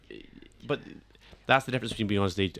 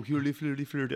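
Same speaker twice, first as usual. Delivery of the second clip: higher, lilting mock Norwegian gibberish; mock Norwegian accent; 6 semitones higher, 4 dB quieter than usual. Explaining the Muppets chef to a co-host, mock Norwegian accent. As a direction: imitate the Muppets chef's Norwegian-sounding babble. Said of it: "that is more of the Norwegian accent".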